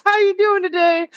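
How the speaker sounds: high, sweet shop greeting to a mother; nice clerk voice. Retold or imitated. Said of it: "like a mother coming in and being like".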